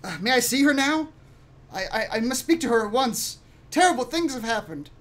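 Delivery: put-on high, urgent young voice; high-pitched boy with pressing news; boy in character. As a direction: plead for an audience, stammering. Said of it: "Uh, Arden says".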